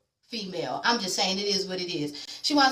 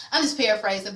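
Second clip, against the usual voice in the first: usual speaking voice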